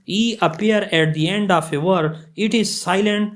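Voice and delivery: reading the rule out word by word, very slowly; slow dictation. Shown as a demonstration. Teacher to students, reading the rule at dictation pace.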